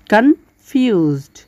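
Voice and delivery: কনফিউজড said slowly in two syllables, con-fused; careful two-syllable demo; split into syllables. Shown as a demonstration. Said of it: "দুই ভাগে ভাগ করেছি আমরা".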